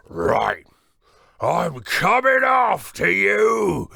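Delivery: loud slow giant threat; giant threatening. Acted. Giant threatening Jack from the beanstalk top, booming. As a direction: boom it down the beanstalk. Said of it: "He looked down at Jack and said".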